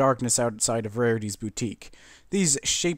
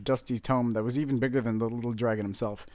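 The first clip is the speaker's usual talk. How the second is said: ordinary delivery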